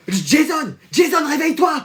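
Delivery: high shouted name; calling out. Acted. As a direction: yell across the room to wake him. Calling friend to wake up.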